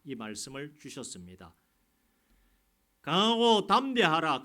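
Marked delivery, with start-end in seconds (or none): 3.0-4.4 much higher and louder, slow, hammering 강하고 with a falling end; forceful preaching. Real, not acted. Pastor urging the congregation, loud and slow. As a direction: shout the command like a rallying cry.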